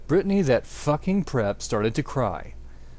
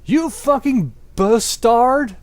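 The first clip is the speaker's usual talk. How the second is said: high, loud, slow furious yell; hammed-up furious teen yell; 9 semitones higher, 8 dB louder, slower than usual. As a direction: yell it at your rival. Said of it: "yelled Draco at Vampire".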